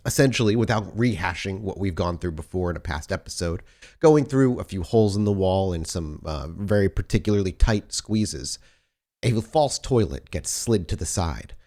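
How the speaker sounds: usual speaking voice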